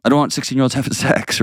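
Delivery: laughs mid-sentence, quick and amused; laughing. Real, not acted. Host to callers, laughing as he states it. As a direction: chuckle through the word sex. Laughs while stating view.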